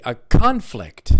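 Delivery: slow CON-flict, strong start; first-syllable stress. Shown as a demonstration. Teacher leads repetition of the noun, CON-flict. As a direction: hit the CON in "conflict". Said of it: "nouns are stressed on the first syllable".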